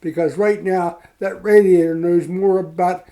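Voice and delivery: imitating a fed-up sponsor; mock-angry question to the drunk; blunt retold demand. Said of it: "blunt from the heart".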